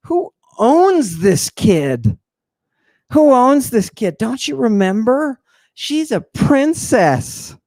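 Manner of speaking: drawn-out, high, mock-outraged demand; hammed-up indignation, pitched high; indignant priest. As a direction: act out an affronted priest, stretching the key words.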